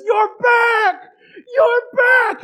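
put-on high, loud, crying shout of a boy; weeping cry of relief; sobbing joyful shout. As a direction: bawl out joy as the old dog limps back. Boy bawling as old dog returns.

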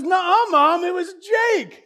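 put-on high shouting kid blaming someone else; whiny kid denial. Rusty blaming Jake to his mom, whiny shout. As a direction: whine the blame onto Jake. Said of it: "He says".

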